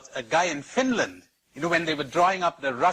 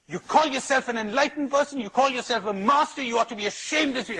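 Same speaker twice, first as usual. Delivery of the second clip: mock-indignant, higher-pitched rant; outraged protest; 8 semitones higher than usual. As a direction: play the man's offended fury, pitched up. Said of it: "the man went livid with rage".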